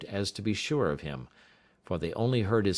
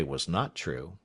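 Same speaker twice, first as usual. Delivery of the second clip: ordinary delivery, nothing marked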